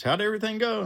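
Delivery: high, cheery question; high dispatcher voice. Retold or imitated. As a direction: lift the pitch up for her.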